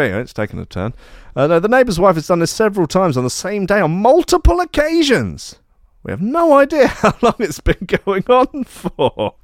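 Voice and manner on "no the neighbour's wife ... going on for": high-pitched, put-on outraged reading; hammed-up high voice of a wronged homeowner; aggrieved complainant. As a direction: read the complaint like an indignant neighbour. Reads out neighbour's complaint post.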